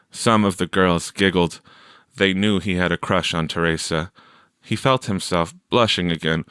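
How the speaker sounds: plain reading voice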